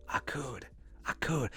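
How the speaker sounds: very soft mock Mississippi accent; hushed, musing Mississippi accent. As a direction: ponder it softly in a Mississippi accent. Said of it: "has a Mississippi accent".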